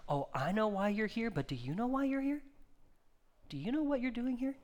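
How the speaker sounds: high, lilting questions rising at the end; God probing gently. Acted. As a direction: probe knowingly, lifting the pitch. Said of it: "and he's saying".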